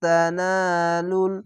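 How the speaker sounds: drawn-out recited তানালুল with nasal ghunna held long; Quranic recitation. Shown as a demonstration. Teacher recites the word for students, slow and drawn out. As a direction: chant তানালুল slowly, stretching each vowel. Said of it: "এখানে ইকফা গুণনা".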